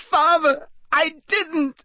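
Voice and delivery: high desperate shriek; frantic high shriek of denial. Acted. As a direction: shriek it in terror at your father. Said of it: "shrieked the boy".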